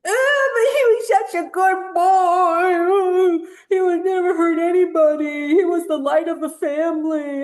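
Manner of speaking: high, loud, teary mocking wail; mock grieving family. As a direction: whine like a sobbing relative. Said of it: "his family's gonna be like".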